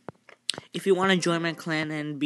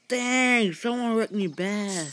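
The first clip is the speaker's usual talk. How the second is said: loud, high cry of dismay; dismayed outburst; 6 semitones higher, 5 dB louder, much slower than usual. Real, not acted. Gamer finds someone wrecked the village, loud dismay. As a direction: drag it out loudly in real dismay.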